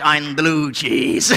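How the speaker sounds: slow, low cheese voice; low blue cheese voice. Acted. Blue cheese explaining itself, slow low voice. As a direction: drag it out low and mournful. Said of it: "cheese answers".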